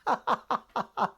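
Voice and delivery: a burst of real laughter; hearty laugh. Real, not acted. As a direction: laugh at the silly mix-up. Laughing at the mix-up.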